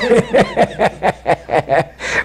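long, rapid chuckle at own story; real amused belly laugh; hearty laugh. Real, not acted.

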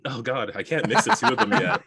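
ordinary delivery

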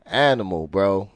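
ordinary delivery

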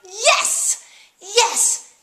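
high, thrilled cheer; mock delight. Reading the mail ad, overjoyed cheering. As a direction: cheer like it's a brilliant idea. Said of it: "my first thought was like".

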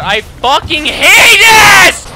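loud, high furious outburst; raging. Real, not acted. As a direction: scream it in real frustration at the game. Raging at the game.

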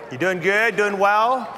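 usual speaking voice

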